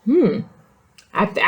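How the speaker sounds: ordinary delivery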